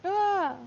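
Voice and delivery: sharp high startled cry; loud surprised yelp. Real, not acted. Sudden slip while tying fly.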